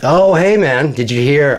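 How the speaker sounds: mock friendly greeting. Taunting the cursed guy.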